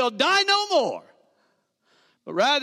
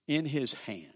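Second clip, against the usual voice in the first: whisper; 10 semitones lower, 10 dB quieter than usual. Acted. Sermon climax drops to whisper.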